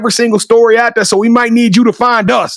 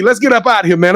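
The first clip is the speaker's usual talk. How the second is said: usual speaking voice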